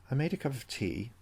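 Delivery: plain reading voice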